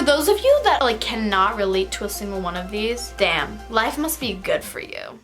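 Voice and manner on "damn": ordinary talk, nothing marked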